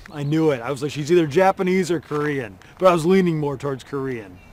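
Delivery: triumphant excitement. Acted. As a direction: crow over a right guess. Guessing his heritage, triumphant.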